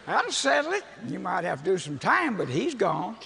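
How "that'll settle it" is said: laughing high through the words. Real, not acted. Preacher laughing at his own joke to the crowd, laughing. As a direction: crack up while saying it.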